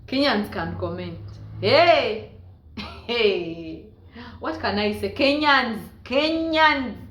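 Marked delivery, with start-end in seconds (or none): 1.6-3.9 playful loud exclamation; exclaiming. Real, not acted. 5.1-7.0 loud, higher teasing call; playful loud call to Kenyan commenters. Real, not acted. Calling out to Kenyans.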